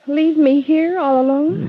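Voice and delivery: loud, slow pleading protest; anxious plea, drawn out. Acted. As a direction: beg him not to leave her alone. Jan begs Johnny not to leave.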